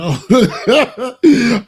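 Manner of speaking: sudden loud burst of laughter; real laughing outburst. Real, not acted. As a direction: crack up hard.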